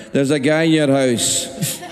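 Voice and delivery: slow Scottish parent impression; drawn-out Scottish accent, mock alarm. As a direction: report it as an old-school Scottish parent. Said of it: "old school Scottish".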